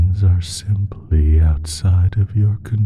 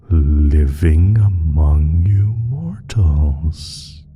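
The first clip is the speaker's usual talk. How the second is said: ordinary delivery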